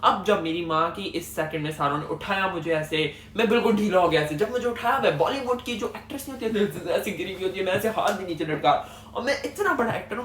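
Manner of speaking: ordinary talk, nothing marked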